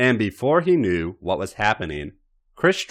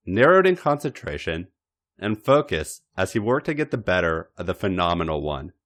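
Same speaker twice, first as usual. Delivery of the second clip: usual speaking voice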